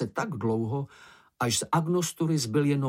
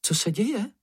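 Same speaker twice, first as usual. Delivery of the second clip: high, jumpy little cry; startled shriek; 9 semitones higher than usual. A narrator's reading. Slečna Ferka still jittery and startled, high yelp. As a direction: yelp the question, pitched high. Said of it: "vyjekla slečna Ferka".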